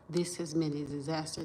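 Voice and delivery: ordinary talk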